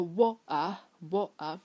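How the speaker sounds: Cockney "water", t swallowed to a glottal stop; slow Cockney wo'uh with no r; Cockney accent. Shown as a demonstration. Teacher imitates London speech, Cockney glottal "water". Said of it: "Cockney它就是发的是".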